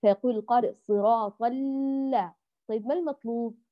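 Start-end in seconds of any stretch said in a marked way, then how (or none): 0.7-2.3 ال- held on an overlong, droning L; over-stretched doubled lam. Shown as a demonstration. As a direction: drag out the lam of ال- far too long. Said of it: "ولا نمططه".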